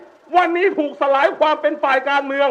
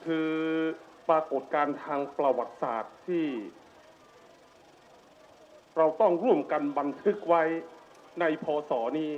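solemn low opening; 11 semitones lower, 12 dB quieter than usual. Real, not acted. Solemn opening of rally speech.

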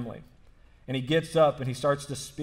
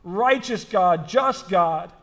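raised, emphatic sermon voice; higher-pitched, insistent pulpit delivery; impassioned preaching; 7 semitones higher, 6 dB louder than usual. Real, not acted. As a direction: preach it with force, driving each phrase home. Preacher builds from story into sermon.

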